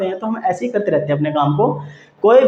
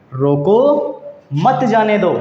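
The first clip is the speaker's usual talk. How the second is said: रोको drawn out, pause, then मत जाने दो; pause after रोको; 3 semitones higher, much slower than usual. Shown as a demonstration. Teacher demonstrating comma placement, pause right after रोको. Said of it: "रोको के बाद अल्प विराम लगा दिया".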